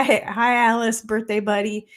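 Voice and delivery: ordinary talk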